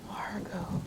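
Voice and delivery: breathless whisper of the name; children stunned by what they did. A narrator's reading. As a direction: barely breathe it, ashamed. Guilty whisper.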